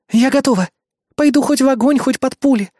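high, gung-ho female-character vow; eager devotion. A narrator's reading. Reaper eagerly volunteers for war.

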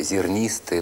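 ordinary delivery

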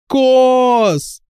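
very high shout of a name. A narrator's reading. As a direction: call out his name, pitched very high. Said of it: "закричала она".

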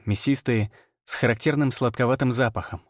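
ordinary delivery

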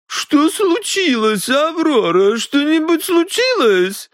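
very high, slow, anxious woman; plaintive companion. Acted. Companion asks Аврора what happened, plaintive. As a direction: fret slowly in a very high voice.

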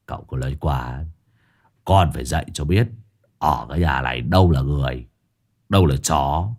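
low, easy chuckling reply; lightly voiced grinning servant. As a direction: smirk through the lines. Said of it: "Hải Nghẹo cười cười".

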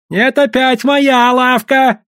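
slow, high cry of protest; defiant shout. A narrator's reading. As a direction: shout back the claim, drawn out. Gamblers quarrel over the shop.

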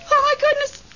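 high sharp gasp of fright; shocked, high-pitched alarm; frightened gasp. Acted. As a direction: gasp as something dashes past. Something runs through the room.

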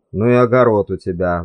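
ordinary delivery, nothing marked

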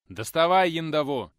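gruff growled command; growling. A narrator's reading. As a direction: growl out the order. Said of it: "рыкнул Николай Сергеевич". (рычит)

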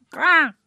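high, loud crow cry; crow caw. Acted. The bird cawing as it flies up, loud caw.

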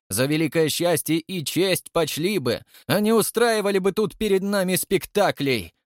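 high-pitched, heated scolding; angry tirade. A narrator's reading. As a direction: berate him in an outraged, high voice. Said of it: "в гневе председатель". (гневная отповедь на высоких тонах)

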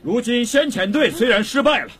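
high, fervent address to a crowd; rousing oration. Acted. Captured commander addressing his countrymen, rousing.

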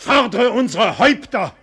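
high, loud defiant cry; defiant outburst. Acted. Nobleman defying the royal envoy, loud and high. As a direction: fling the challenge in his face. Egmont defies the king's envoy.

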